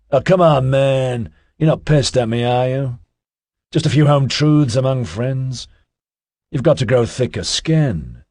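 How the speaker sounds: a hint of a cocky, chatty guy; Ozzy's voice. A narrator's reading. Ozzy's voice in narration.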